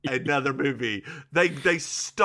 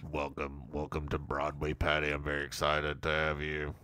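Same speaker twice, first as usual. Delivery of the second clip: much lower, quieter mock speech voice; Barry's speech; 12 semitones lower, 9 dB quieter than usual. Host voices Barry toasting Patty, low and quiet. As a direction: drop the voice deep and soft for Barry. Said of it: "Barry stands up to make a speech".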